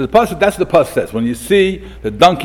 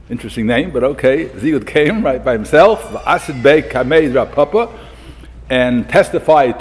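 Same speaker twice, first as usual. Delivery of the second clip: ordinary delivery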